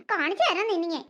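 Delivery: low, soft scheming vow under the breath; muttered threat. Acted. Jealous girl vowing revenge on her rival, low soft mutter.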